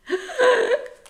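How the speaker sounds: very high, loud startled gasp; sharp gasp. A narrator's reading. Fifi is grabbed by the big dog, a sharp startled gasp. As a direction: gasp in sudden shock.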